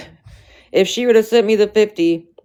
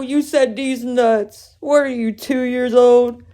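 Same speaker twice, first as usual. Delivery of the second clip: mock teasing; 6 semitones higher, slower than usual. Real, not acted. Mocking a childish joke.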